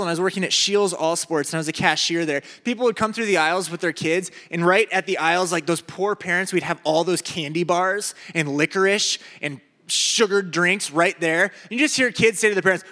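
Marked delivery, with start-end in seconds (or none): none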